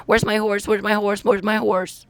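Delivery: high, insistent mock whine; mocking imitation. Ridiculing his endless asking about a lost horse, high mocking imitation.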